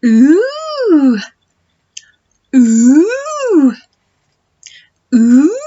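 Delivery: loud high gasping ooh; mock shocked gasps. Shown as a demonstration. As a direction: sound truly shocked. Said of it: "You are shocked".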